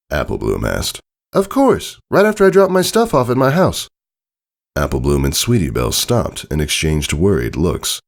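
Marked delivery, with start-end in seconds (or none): 1.3-3.9 high filly voice, casual; breezy little pony, no worries; young Scootaloo. A narrator's reading. Scootaloo telling friends she'll stop home first, high and breezy.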